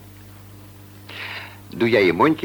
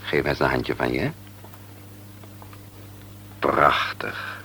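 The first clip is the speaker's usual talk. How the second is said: whisper; 5 semitones lower, 7 dB quieter than usual. Acted. Doctor praises sick child softly.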